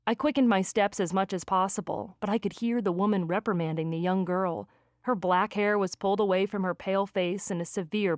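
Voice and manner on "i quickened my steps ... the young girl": plain reading voice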